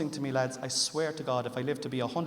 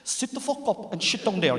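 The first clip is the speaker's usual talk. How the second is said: mock angry wife; 8 semitones higher, 5 dB louder than usual. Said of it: "she's a lovely way with words".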